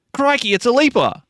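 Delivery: a cheeky Aussie streamer shouts it for chat in a mock Australian accent; broad Aussie accent. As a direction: play a loud Queensland bogan spotting a monster. Mock Aussie bogan exclamation.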